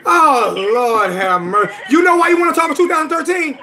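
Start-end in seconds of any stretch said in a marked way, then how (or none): 0.1-1.7 exasperated sigh. Real, not acted. Exasperated at the opponent.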